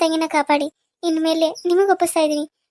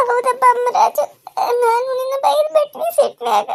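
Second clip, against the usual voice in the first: high, sobbing apology; weeping, remorseful mother; crying; 5 semitones higher than usual. Acted. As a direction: beg forgiveness through tears. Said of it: "ಅಳ್ಬೇಡ್ರಿ".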